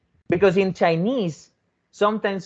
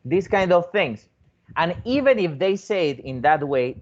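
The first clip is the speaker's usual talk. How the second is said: ordinary talk, nothing marked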